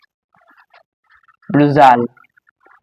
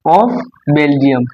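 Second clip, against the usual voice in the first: dictation; much slower than usual. Shown as a demonstration. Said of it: "dictating title to class".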